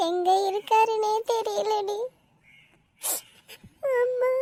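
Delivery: crying, voice rising in pitch; helpless, choked-up wail at the end; tearful. Acted. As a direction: lament a missing husband through tears. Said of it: "அழாதடி".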